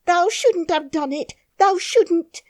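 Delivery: frightened Yorkshire maid; half crying. A narrator's reading. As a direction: plead near tears. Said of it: "half crying".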